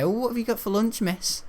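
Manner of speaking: high-pitched imitation of a kid; chirpy pupil asking. Pupil asking the maths teacher about lunch, high mock kid voice. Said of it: "some of the kids even ask her".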